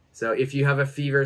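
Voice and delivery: ordinary talk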